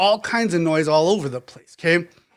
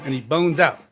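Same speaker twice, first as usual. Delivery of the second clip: usual speaking voice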